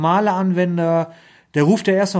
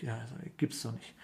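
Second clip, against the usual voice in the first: muttered disbelief; 6 semitones lower, 20 dB quieter, faster than usual. Retold or imitated.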